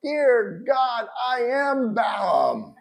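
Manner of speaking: loud, high, grandly drawn-out prayer; self-important Balaam. Acted. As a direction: announce yourself to God with mock pomp. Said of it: "in my notes I go".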